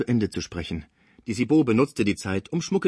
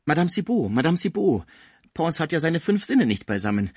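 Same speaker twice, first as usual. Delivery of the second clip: higher and louder, desperate appeal; pleading old German; 7 semitones higher, 4 dB louder than usual. A narrator's reading. Schmucke pleads with Madame Cibot, higher and louder. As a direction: beg her to forgive him.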